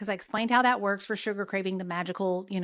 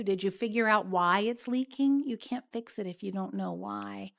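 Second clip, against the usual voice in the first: ordinary delivery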